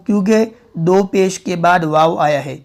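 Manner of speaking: normal talking voice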